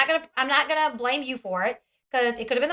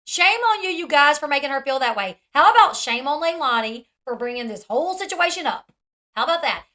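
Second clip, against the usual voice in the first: raised, louder indignant comeback; angry retort; 3 semitones higher, 6 dB louder than usual. Real, not acted. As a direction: throw the words back at them, fired up. Angrily echoing the clip.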